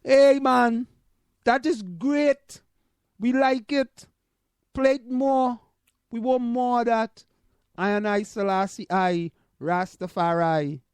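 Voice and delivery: high, cheerful Jamaican accent impression. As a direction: play a delighted Jamaican, voice lifted. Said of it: "What do you think the Jamaican says?".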